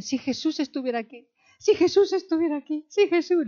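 acted urgent yearning; acted longing cry. Voices disciples wishing Jesus were there.

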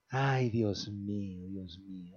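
soft chuckling "ay, Dios mío"; laughing. Real, not acted. Pastor laughs softly, remembering childhood tonics, real laughter. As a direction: laugh it out quietly, reminiscing.

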